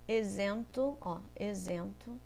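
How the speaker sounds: Isento with a z sound, rising at the end. Shown as a demonstration. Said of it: "O som é de Z". (isento com som de Z)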